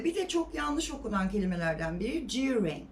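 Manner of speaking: ordinary talk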